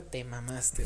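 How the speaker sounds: mock low grumble. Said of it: "me veía así con cara".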